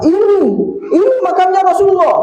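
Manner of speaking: very high, impassioned cry; acted overwhelmed cry at the top of the voice; overwhelmed exclamation. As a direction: exclaim as if finally finding what was longed for. Boy reaches the Prophet's tomb.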